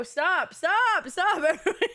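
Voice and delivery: rising to loud, high laughing stop; laughing protest. Real, not acted. Chat spoils riddle, she protests.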